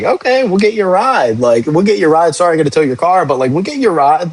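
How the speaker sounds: high, bright reassuring cop to a nice driver; friendly reassurance. Retold or imitated. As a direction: soothe them, we'll sort you a ride. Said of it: "I'm like".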